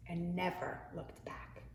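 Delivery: soft whisper, leaning in; quiet wondering whisper; whispered suspense. A narrator's reading. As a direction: hush it for the listeners.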